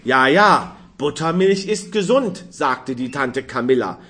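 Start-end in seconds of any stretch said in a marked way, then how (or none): 0.0-2.4 bright, louder burst of cheer; a cheerful outburst from the aunt; suddenly cheerful. A narrator's reading. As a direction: chirp it out gladly. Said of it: "plötzlich fröhlich".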